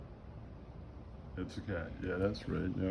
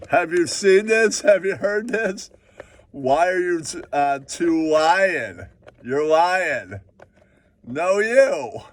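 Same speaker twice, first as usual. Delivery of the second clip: excited showbiz voice; 5 semitones higher, slower than usual. Acted. Bragging about Hollywood, a pitched-up excited hype. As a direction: sell it like a hyped-up host.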